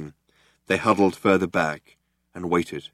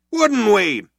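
loud, high warning; tough cop on a hailer; 13 semitones higher, 4 dB louder than usual. A narrator's reading. Cop warning Zaphod, loud and high. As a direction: lay down the law. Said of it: "he asked the other one".

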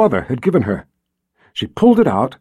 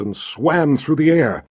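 ordinary delivery

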